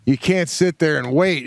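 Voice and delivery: ordinary talk, nothing marked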